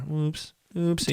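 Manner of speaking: high, soft little oopsie; mock-innocent oops. Admitting the untaxed car to co-host, mock innocence. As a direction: play coyly innocent, softly and high.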